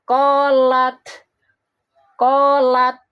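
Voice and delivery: "Kolat" with the final t stopped and then released with a small puff of air; "Kolat" with the t bounced out cleanly; correct qalqalah. Shown as a demonstration. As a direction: end "Kolat" on a held t that lets the breath escape. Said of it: "Jadi harusnya begini".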